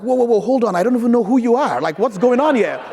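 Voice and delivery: loud, high, startled retold reaction; alarmed protest. Man to an unknown caller, loudly taken aback.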